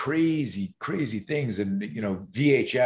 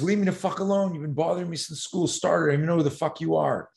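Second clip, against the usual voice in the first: retold outburst; 6 semitones higher, faster than usual. Mitchell finally stands up.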